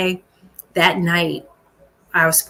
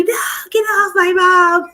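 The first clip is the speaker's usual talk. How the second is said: put-on much higher, louder, panicked child's cry; imitating frantic crying daughter; 12 semitones higher, 3 dB louder than usual. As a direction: mimic a scared kid sobbing for her mom. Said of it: "she's crying".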